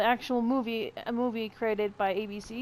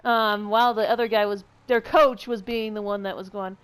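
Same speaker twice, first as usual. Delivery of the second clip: ordinary talk, nothing marked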